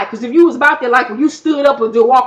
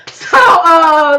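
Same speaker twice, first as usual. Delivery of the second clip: laughing; 5 semitones higher, 7 dB louder than usual. Real, not acted. Laughing burst at episode highlight.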